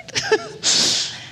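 teary half-laugh, half-cry breath; a sob escaping through a laugh; real choked sob. Real, not acted. Chaplain breaking down mid-story with a teary gasp. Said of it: "I'm gonna get a little emotional".

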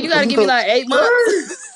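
high voice, breaking into laughter; mock the character, laughing; imitating, high and laughing. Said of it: "he was like".

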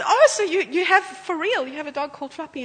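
amazed question. Retold or imitated. Prophet asking the couple about the dog, amazed. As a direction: lean in amazed. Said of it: "So he says".

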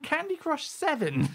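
very high-pitched incredulity; mini rant. Real, not acted. Cohost aghast at Candy Crush's price, ranting. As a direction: squeak it in sheer disbelief. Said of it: "Mini rant over".

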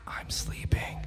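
sleepy whisper; unvoiced whispered reply; whispered back. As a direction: whisper it half asleep. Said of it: "and then I whisper back".

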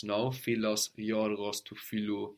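ordinary talk

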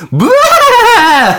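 very high, loud, drawn-out "bah"; long shrieking yell to make someone jump; jump-scare scream. Retold or imitated. Friend popping up from bushes to scare hikers, screamed. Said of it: "he just pops up going".

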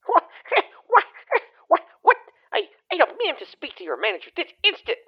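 high indignant shrieks of what; outraged shriek. Acted. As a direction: squeal in offended disbelief. Outraged Karen.

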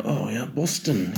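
slow, put-on British slang accent; imitated British accent. Mimicking a friend's slang back at him, imitated British accent. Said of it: "when I imitate".

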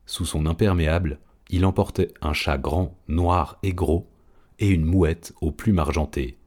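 ordinary delivery, nothing marked

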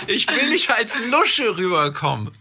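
slow, laughing through the words; laughing quote. Retold or imitated. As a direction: echo him while laughing. Host echoes caller, laughing.

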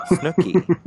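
high, loud mock yell. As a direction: yell the name in a mock shriek. Imitating Snooki yelling.

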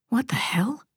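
hushed, voiceless exclamation; startled whisper. Acted. Startled reaction to a crash.

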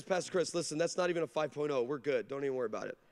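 low, soft, unbothered; calm reassurance. Retold or imitated. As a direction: settle him down gently. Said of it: "the pastor leans back and says".

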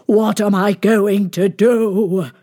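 loud, drawn-out wail of despair. A narrator's reading. King slumped in his throne, despairing wail. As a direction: wail it slowly and loudly. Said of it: "he wailed".

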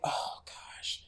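soft, whispered aside; mock dismay. Art reacts to the police arriving, whispered mock dismay. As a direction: whisper it under your breath.

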